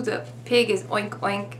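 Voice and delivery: normal talking voice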